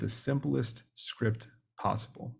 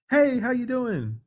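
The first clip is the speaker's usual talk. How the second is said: much higher, slow, sing-song hello; overly cheery greeting; 13 semitones higher, 5 dB louder, slower than usual. Retold or imitated. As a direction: greet like a pushy salesman. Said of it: "someone who's like".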